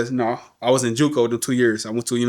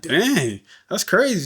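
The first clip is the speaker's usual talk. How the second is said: surprised; 7 semitones higher, slower than usual. Retold or imitated. Reacting to mom's confession.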